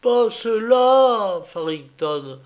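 moaning, muffled behind a mask.

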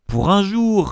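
high, loud comeback from the professor; sharp retort. A narrator's reading. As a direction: fire back impatiently. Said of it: "répliqua le professeur". (réplique vive)

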